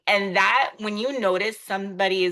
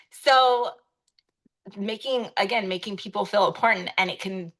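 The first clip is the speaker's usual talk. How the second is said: ordinary talk, nothing marked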